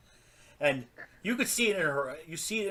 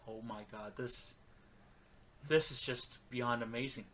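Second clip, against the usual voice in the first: much softer, lower, awestruck aside; hushed amazement; 3 semitones lower, 10 dB quieter than usual. Retold or imitated. Retelling his reaction to the promo, quietly amazed. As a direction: recall being stunned, almost to yourself. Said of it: "I sat there in amazement".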